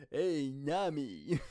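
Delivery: put-on slow, hammy Brooklyn accent; fake Brooklyn accent. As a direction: overdo a Brooklyn accent, drawn out. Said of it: "such a fake Brooklyn accent".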